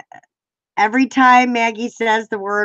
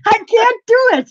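high, louder mock whine of the friend; mimicking friend; 9 semitones higher, 5 dB louder than usual. Imitating Maggie's serious complaint, high and a bit louder. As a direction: put on her friend's dramatic complaint. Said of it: "She'll be".

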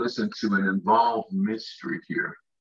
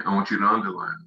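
ordinary talk

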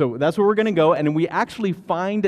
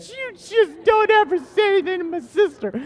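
put-on little boy threat; 5 dB louder than usual. Angry little boy threatening teenagers.

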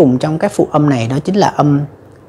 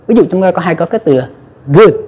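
ordinary talk

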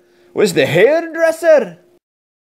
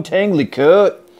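plain reading voice

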